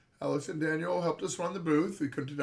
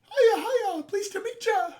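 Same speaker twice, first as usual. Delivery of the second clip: high squeaky little creature voice; Meep voice; 8 dB louder, slower than usual. Acted. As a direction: chirp it out like a tiny cheerful critter. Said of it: "I shouldn't make voices".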